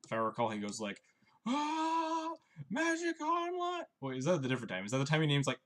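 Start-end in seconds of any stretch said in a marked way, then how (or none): 1.5-3.8 much higher, slow, hammed-up angry yell of "Magic Online"; mock rage scream. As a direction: imitate a player raging at "Magic Online", stretched out high. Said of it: "just screams about Magic Online".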